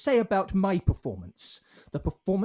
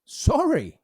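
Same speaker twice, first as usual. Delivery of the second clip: loud, high incredulous "sorry?"; affronted, raised voice; indignant echo; 7 semitones higher, 6 dB louder than usual. Acted. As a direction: throw the apology back sharply. Offended actor throws back her apology.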